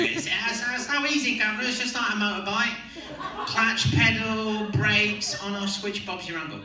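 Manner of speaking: high, loud British bodyguard; British accent. Retold or imitated. Bodyguard explaining the motorbike to the actor, high British voice. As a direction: rattle off instructions in a high-pitched British accent. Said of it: "in the Highest British Accent".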